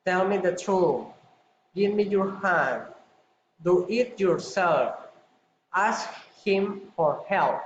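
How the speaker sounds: commands falling on "truth", "hand", "yourself", "help"; falling intonation. Shown as a demonstration. Teacher demonstrates commands, falling intonation on "truth", "hand", "yourself", "help". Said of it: "Commands falling intonation".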